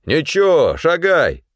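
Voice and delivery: high, brusque call; shouted order. A narrator's reading. Fyodor calling back to the pleading man, shouted order. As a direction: shout it without stopping to argue. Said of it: "крикнул".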